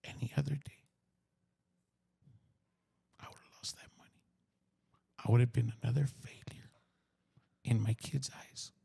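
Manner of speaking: hushed, half-whispered, with long pauses; emotional. Real, not acted. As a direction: let the voice fade nearly to breath. Moved recalling nearly losing the cash.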